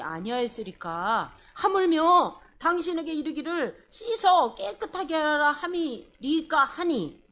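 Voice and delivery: slow, measured reading aloud; scripture reading. A narrator's reading. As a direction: lead the congregation through the verse deliberately. Leads congregational scripture reading.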